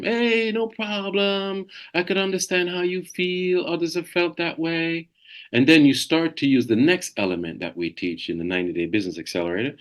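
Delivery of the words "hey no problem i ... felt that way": gentle understanding tone; calm reassuring. Shown as a demonstration. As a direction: soothe the upset client. Role-play of calm client reply.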